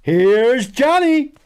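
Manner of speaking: high, slow, loud horror-movie quote; Here's Johnny. Acted. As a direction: drag it out like a horror villain. Movie quote while hunting.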